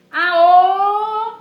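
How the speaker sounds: high, loud full yell; loudest shout. Acted. Mole answering grandma at full volume. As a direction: shout as loud as you can. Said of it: "o mais alto que conseguia".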